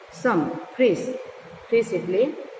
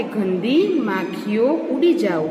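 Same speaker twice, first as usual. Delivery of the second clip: usual speaking voice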